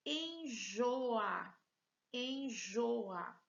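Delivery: enjoa drawn out as en-jo-a; syllable by syllable. Shown as a demonstration. Teacher presenting a word to students, enjoa in stretched syllables. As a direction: sound out each syllable of enjoa. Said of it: "Vejam que nós temos três".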